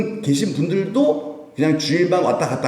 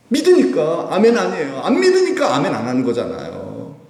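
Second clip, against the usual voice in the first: ordinary talk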